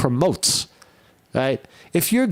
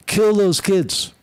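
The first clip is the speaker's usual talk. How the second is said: high, forceful mock shout; reading a threat aloud with mock fury; mock outraged read; 8 semitones higher than usual. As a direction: bark the quote out high and hard. Reading shooter's manifesto aloud.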